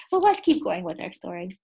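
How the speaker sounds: ordinary delivery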